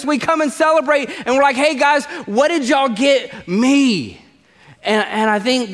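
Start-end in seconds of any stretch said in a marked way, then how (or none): 1.6-4.2 high, slow, grabby "what did you get me"; hammed-up entitled whine at a birthday; greedy party guest. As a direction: play the self-centred guest who only wants presents. Acting an entitled Christmas guest.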